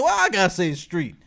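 normal talking voice

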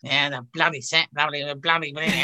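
a grumpy private moan after the smile; grumbling Barbara. Retold or imitated. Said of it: "afterwards she'd go".

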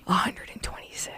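hushed guilty confession; sheepish whisper. Real, not acted. Driver confessing top speed to co-host, sheepish whisper. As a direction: whisper the figure, reluctant to admit it.

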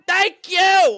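high, loud comic yell; shouting for grandma. Retold or imitated. Grandson on the phone to deaf grandma, comic shouting. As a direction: yell it to someone hard of hearing. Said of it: "you kinda have to shout at her".

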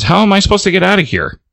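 high, fast frantic question; exasperated, high and quick. Retold or imitated. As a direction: rattle it off in a panic. Character trapped by monsters.

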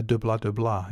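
rhythmic vocalised flam pattern; drum speak. Shown as a demonstration. Drummer voicing a flam pattern for listeners, drum speak. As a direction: sound out drum strokes with nonsense syllables. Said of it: "Good bit of drum speak there".

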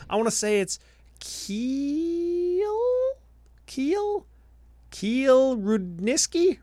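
Keel said slowly with a rising question tune; careful name pronunciation. Retold or imitated. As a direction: Keel Rudniski spelled out slowly, high and unsure. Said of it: "I'm gonna say it how he said it".

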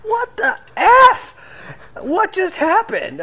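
high, loud shocked cry with a drawn-out pace; shocked outburst. Retold or imitated. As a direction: blurt it out like someone stunned by a miracle. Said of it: "someone was like".

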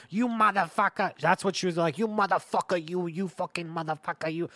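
high, loud furious cursing; furious grandma. Retold or imitated. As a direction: curse the possum like a gangster. Grandma stabbing possum, screaming.

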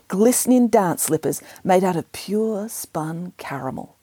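ordinary delivery, nothing marked

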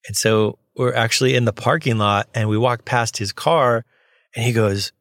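ordinary delivery

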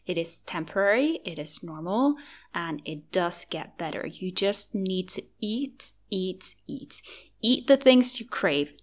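ordinary talk, nothing marked

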